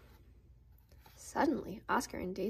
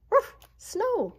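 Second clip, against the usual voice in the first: high, loud happy yelp; excited dog bark; 11 semitones higher, 8 dB louder than usual. A narrator's reading. Dog thrilled by the snow, loud and high. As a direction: burst out with joy at the snow. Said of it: "barked Oscar".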